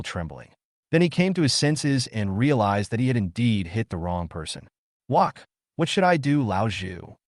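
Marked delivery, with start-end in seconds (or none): none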